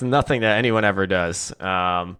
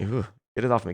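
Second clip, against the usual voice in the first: soft, grossed-out ooh; mock squeamish; 6 dB quieter, faster than usual. Caster shrinking from a wrong name on screen, quiet mock disgust. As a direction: recoil from it under your breath.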